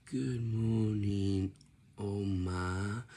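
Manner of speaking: slow, sleepy greeting to Oma; groggy just-woke-up voice. Shown as a demonstration. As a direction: drawl it like you just got out of bed. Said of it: "you sound like this".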